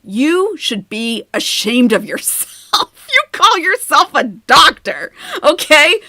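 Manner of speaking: loud, slow scolding that breaks into laughter. Retold or imitated. Retelling how she told off the doctor, loud and laughing.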